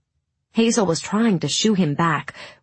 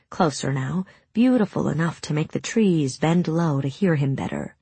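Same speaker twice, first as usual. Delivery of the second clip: ordinary delivery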